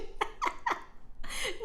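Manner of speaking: airy laugh with no voice; a soft breathy giggle; quiet airy laughter. Real, not acted. Laughs after the tease.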